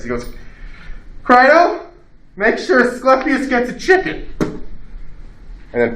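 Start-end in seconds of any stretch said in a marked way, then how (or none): 1.2-4.3 Socrates' last words. Retold or imitated. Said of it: "I'm just gonna say this".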